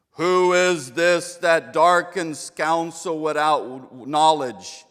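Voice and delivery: loud, slow, booming James Earl Jones-style God. Acted. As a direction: thunder it out from the whirlwind. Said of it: "what I think voice of God would be like".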